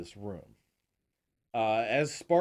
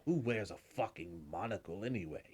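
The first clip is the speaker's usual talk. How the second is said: normal talking voice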